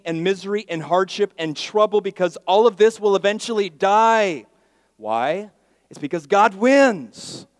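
loud, high, slowing preacher's cry; full-force preaching. Acted. Pastor lifting the congregation, preaching at full force. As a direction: hit it like the peak of a sermon.

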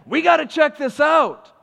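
high, loud, eager; like excited shepherds bursting to go; eager paraphrase. Retold or imitated. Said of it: "another way of saying".

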